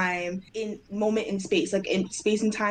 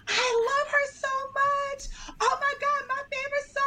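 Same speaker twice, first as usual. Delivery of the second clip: screaming fan. Retold or imitated. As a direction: gush like a crying superfan. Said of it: "she's screaming".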